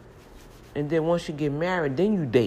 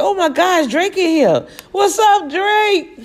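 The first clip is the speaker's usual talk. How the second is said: high, loud starstruck squeal; retold fan squeal, much higher and louder; 9 dB louder, slower than usual. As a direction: shriek like you just spotted a celebrity. Mistakes [name] poster for [name].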